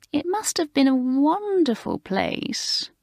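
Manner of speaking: butter her up with mock interest; smooth voice. A narrator's reading. Alicia teasing the new girl Maureen, smooth and slow. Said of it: "in her smooth voice".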